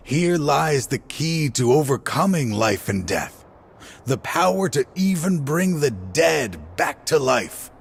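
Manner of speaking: rising zeal, almost shouting the promise; fervent. Acted. Grieving father reveals his plan to Cyno, fervent and rising.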